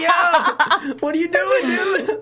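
high, loud, incredulous outburst; mock alarmed yell. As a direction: act out panicked disbelief. Acts out alarm at distracted instructor.

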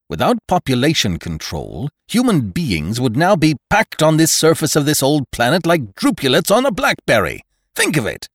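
loud, high, impassioned harangue; severe doctor. A narrator's reading. Doctor warns father about an overpacked planet, severely. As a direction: press the warning home with rising force.

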